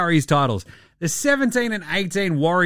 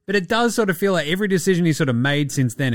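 normal talking voice

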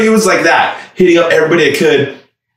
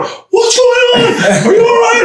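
yelling from shower; slower than usual. Retold or imitated. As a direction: call out through the shower noise. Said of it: "he's yelling from the shower".

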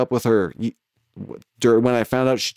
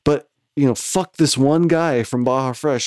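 ordinary talk, nothing marked

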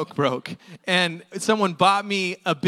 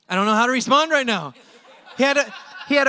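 laughing; 2 semitones higher, 4 dB louder, faster than usual. Retold or imitated. Baffled by healed man.

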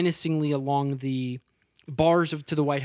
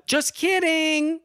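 high, slow sing-song just kidding; playful take-back; 11 semitones higher, much slower than usual. Retold or imitated. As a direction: drag it out high and innocent. Character retracts threat playfully.

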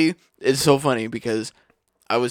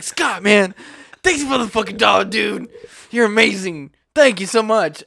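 raised, louder fan voice; excited thanks; 6 semitones higher, 4 dB louder than usual. Retold or imitated. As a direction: imitate someone thrilled and grateful. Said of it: "He was so excited".

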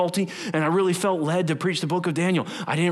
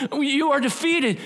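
voice of lies; 7 semitones higher than usual. Acted. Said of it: "Don't listen to the lie".

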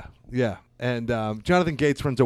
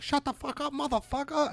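host yelling, pitched way higher; high mock host yell; 12 semitones higher, 5 dB quieter than usual. Host to heckler, high mock yell. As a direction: squeal it like an angry club host. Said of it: "be like".

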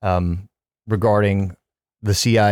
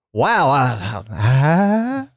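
ordinary talk

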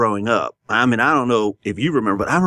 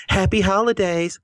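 mimicking a young clerk's sing-song greeting, pitched higher; cashier's holiday greeting; 10 semitones higher than usual. Retelling a store clerk's greeting to his co-host, mock chirpy. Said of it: "She goes".

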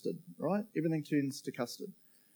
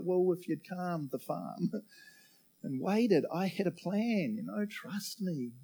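God's gentle reply; 4 semitones higher, 3 dB quieter, slower than usual. Pretend. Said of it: "And he's like".

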